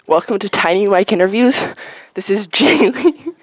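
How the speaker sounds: loud, projected host opening a pretend show; mock interviewer. Podcast host opening a joke interview, loud mock-announcer delivery. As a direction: play an interviewer, big and loud.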